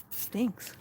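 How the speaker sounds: usual speaking voice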